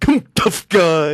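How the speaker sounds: put-on slow, drawn-out old film comic's growl of bravado; Bert Lahr. Comedian likens a wrestler to the Oz lion actor, slow blustery impression. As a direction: stretch out a blustering cowardly-lion challenge. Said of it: "a little bit of Bert Lahr".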